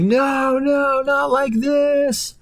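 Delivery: drawn-out high terrified plea; high slow plea. Retold or imitated. Hot dog pleading before the boiling pot. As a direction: beg for your life, way up high.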